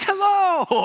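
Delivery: high, loud, gleeful park-greeter hello; over-the-top happy. Retold or imitated. Disney attendant greeting arriving guests, over-the-top happy. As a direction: sing out an absurdly cheery greeting. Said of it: "he's way over the top happy".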